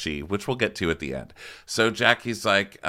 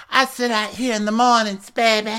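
very high and louder, cooing; Jennifer impression; 14 semitones higher, 6 dB louder than usual. Host showing off her patio, very high and louder. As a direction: coo it high and proud. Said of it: "Jen's like".

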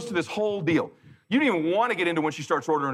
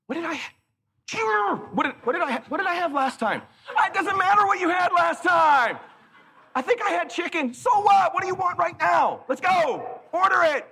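exasperated shout; 13 semitones higher than usual. Retold or imitated. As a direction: yell at her to order. Husband losing patience with wife.

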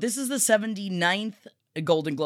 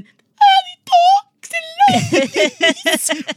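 very high, loud, slow shrieking vampire; mocking Jared Leto as a vampire; Volturi vampire voice; 7 dB louder, much slower than usual. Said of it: "the same voice I did for one of the Volturi".

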